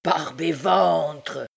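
very slow, drawn-out curse; exasperated oath. A narrator's reading. Clopin cursing his timid men, exasperated. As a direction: swear with heavy emphasis.

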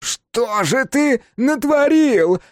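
very high, loud, anguished yell; drawn-out cracking shriek of reproach; yelling, voice breaking. A narrator's reading. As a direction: scream it slowly till the voice cracks. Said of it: "заорал он ломающимся голосом".

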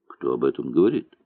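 fast, wooden and hard; bored, stiff retort. A narrator's reading. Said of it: "деревянным и жёстким голосом". (деревянным и жёстким голосом, быстро)

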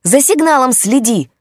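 loud, high shout, "сигналом" stressed. A narrator's reading. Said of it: "пытался докричаться он".

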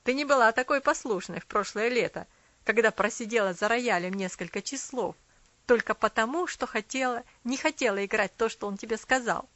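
put-on loud, reproachful boy; accusing boy voice. A narrator's reading. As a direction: jab at her past.

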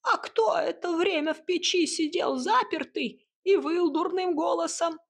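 high-pitched accusing creature voice; taunting hut. Acted. Hut throws the cat's cowardice back at him, high and taunting. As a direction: needle the cat in a high voice.

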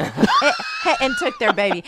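loud laugh-through shout. Real, not acted. Echoing story in laughing shout.

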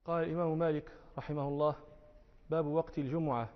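ordinary talk

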